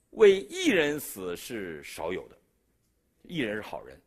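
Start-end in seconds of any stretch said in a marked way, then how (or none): 0.1-2.4 scripture reading. Real, not acted. Quoting Romans.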